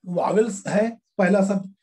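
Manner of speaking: ordinary talk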